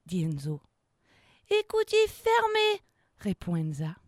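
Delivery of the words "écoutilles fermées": high, loud crew reply; called-out confirmation. Acted. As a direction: report back crisply to the captain. Crew mate confirms captain's order. (réponse aiguë de matelot)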